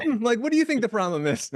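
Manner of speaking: playfully imitating a thoughtful question; musing mentor. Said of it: "he goes".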